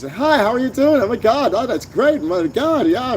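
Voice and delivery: very high, gushing wannabe voice; mock them in a squeaky ninth-grader's pitch; pubescent ninth-grade voice. Host mimicking wannabes who all talk like this, pubescent voice. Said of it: "ninth grade twattage voice".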